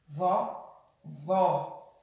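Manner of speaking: "vó" alone with an open ó; "vó" held briefly, o wide open; open vowel. Shown as a demonstration.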